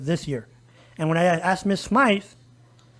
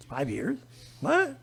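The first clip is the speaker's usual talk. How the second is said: incredulous; 8 dB quieter, slower than usual. Retold or imitated. Reacting to a promise in retold exchange.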